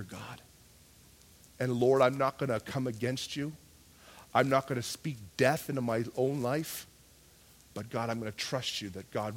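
low, soft, steady prayer; mature trust. Acted. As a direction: confide in God quietly, trusting through hardship. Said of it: "a maturity in the Spirit stands in that place that says".

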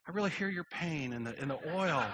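soft, overdone tender concern; mock sympathy. Mock sympathy over oil change.

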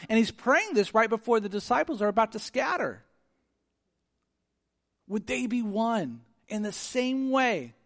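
high, loud, driving sermon voice; impassioned pulpit delivery voicing Jesus' prayer; full-force preaching. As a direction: preach it out with fervor and punch.

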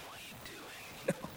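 slow, whispered bewildered question; whispered aside. Retold or imitated. Client privately questioning the counselor's exercise, whispered.

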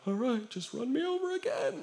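a hint of a self-pitying victim; mock doormat voice. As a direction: lightly whine like a pushover. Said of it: "doesn't lay down and go".

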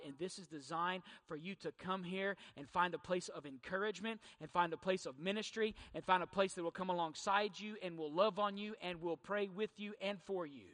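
ordinary talk, nothing marked

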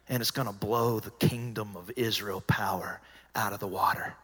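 whispered, low and soft; secretive whisper. Retold or imitated. Jesus whispering the promise of power to the disciples.

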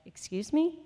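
questioning, pitch swept upward; gently challenging, rising sharply at the end; mild challenge. Retold or imitated. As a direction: question them without any harshness. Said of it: "not in a bad way".

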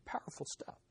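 breathy whispered remark under the breath; whispered aside. Real, not acted. Preacher whispering an aside to the congregation. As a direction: drop to a whisper, as if to yourself.

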